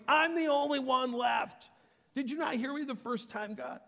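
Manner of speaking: high, shouted grievance; exasperated outburst. Acted. Elijah repeats his speech to God, shouting exasperatedly. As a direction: cry out as if nobody listened. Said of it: "same speech all over again".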